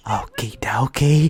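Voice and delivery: hushed, slow okie dokie; whisper. Pretend. Laughing podcaster whispers okie dokie to cohost. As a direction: breathe it out in a whisper. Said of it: "whispered repeat".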